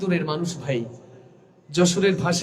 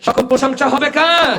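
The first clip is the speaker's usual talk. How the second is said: louder, higher, drawn-out call; rallying shout urging a louder reply; slower shout; 6 semitones higher, 4 dB louder than usual. Real, not acted. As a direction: shout it slowly to fire them up. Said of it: "এত আস্তে বললে ময়মনসিংহের ইজ্জত থাকে না".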